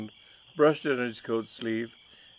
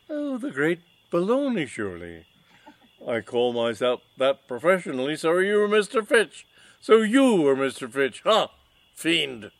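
mustache twirling villain; 6 semitones higher, 8 dB louder than usual. Acted. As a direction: announce your name like a stage villain. Villain accuses Freddy.

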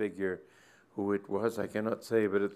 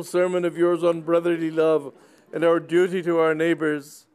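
put-on high, loud old gentleman overcome with emotion; an elderly squire gushing through tears; tearful old squire; 9 semitones higher, 8 dB louder than usual. Sir Leopold to the curate, gushing high and loud.

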